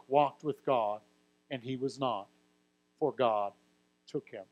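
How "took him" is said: normal talking voice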